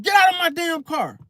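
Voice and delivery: high-pitched reenacted yell; retold angry yell. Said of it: "after yelling at him".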